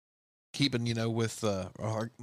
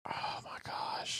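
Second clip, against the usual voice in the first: whispering; 11 dB quieter, much slower than usual. Real, not acted. Awed reaction to the song.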